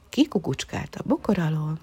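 usual speaking voice

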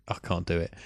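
ordinary talk

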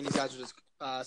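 normal talking voice

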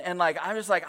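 ordinary talk

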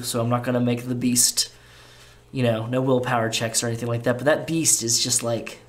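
ordinary talk, nothing marked

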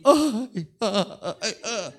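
put-on high, loud childish ay ay ay; crying child. Pastor acting out a child who slipped, high childish wail. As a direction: wail like a little kid who fell. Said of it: "¿qué hace un niño?".

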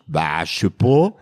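a low, drawled northern French Ch'ti shrug; Ch'ti accent. Retold or imitated. Parisians ask where he is from, he answers in Ch'ti accent. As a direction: answer in his grandma's Ch'ti patois accent. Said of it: "Und ich".

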